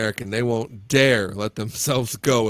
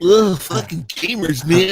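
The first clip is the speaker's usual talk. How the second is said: mock disgust; 6 semitones higher, 6 dB louder than usual.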